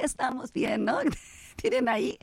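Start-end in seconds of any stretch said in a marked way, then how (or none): 1.6-2.2 giggling through the words; real amused laughter; laughing. Real, not acted. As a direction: laugh while telling it. Laughs at the umbrella makers.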